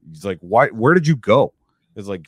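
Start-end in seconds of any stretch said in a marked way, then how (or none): 0.4-1.5 louder, higher mock yell; retold yelling. Manager confronts the worker who walked off, yelling. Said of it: "starts yelling at me by name".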